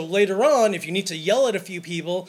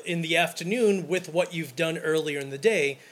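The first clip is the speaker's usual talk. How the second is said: usual speaking voice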